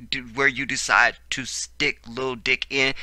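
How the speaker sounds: normal talking voice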